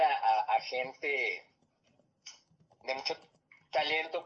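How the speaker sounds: speech broken by hiccups; hiccuping. Real, not acted. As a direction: caught mid-hiccup, words jerky. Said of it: "Perdón, me dio hipo". (hablando con hipo)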